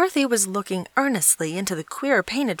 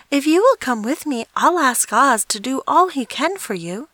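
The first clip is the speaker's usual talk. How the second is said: put-on higher, louder caring girl voice; kind little girl; 8 semitones higher, 5 dB louder than usual. A narrator's reading. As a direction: reassure him as a warm-hearted child. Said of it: "truly sorry for him".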